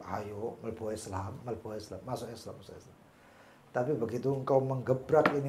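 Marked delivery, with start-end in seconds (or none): 0.1-3.0 soft, low murmur of temptation; hushed, lower urging; coaxing inner voice. Retold or imitated. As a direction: coax gently, under your breath. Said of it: "Kata nafsu".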